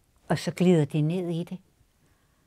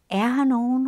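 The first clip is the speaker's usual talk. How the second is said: calling out; 7 semitones higher, 3 dB louder than usual. Acted. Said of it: "råber hun".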